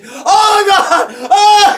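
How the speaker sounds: high, loud, panicked wail; imitated screaming. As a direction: mimic a terrified brother shrieking. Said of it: "he's still back there screaming".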